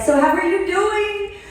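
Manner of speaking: high, eager voice of the advisor; eager friend. Retold or imitated. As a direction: play the cheerful advisor asking for news. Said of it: "and she's like".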